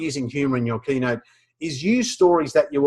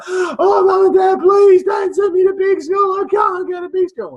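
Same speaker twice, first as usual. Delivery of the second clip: put-on wailing child; 6 dB louder than usual. Little boy begging his parents not to go, high wailing. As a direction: beg your parents through tears. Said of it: "comes home in floods of tears".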